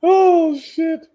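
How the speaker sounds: high startled "oh, shit"; sudden high exclamation; surprised. Real, not acted. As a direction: caught off guard, voice jumps high. Reacts to news on stream.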